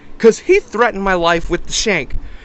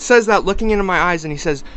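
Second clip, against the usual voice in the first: ordinary delivery, nothing marked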